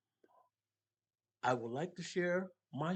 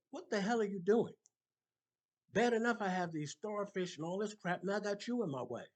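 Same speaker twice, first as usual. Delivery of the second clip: angry stranger; 8 semitones higher, 5 dB louder than usual. Acted. Stranger angry at starfish saver.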